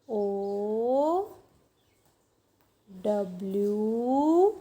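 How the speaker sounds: letters stretched right out; slow, lingering letter names; slow spelling aloud. Shown as a demonstration. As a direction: draw each letter out while writing it. Spelling aloud while writing owl.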